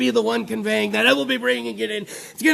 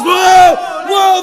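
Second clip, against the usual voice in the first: loud, high whoa; big loud reaction; exclaimed whoa; 6 semitones higher, 10 dB louder than usual. Real, not acted. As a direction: shout it out, pitched up. Exclaims at crude word.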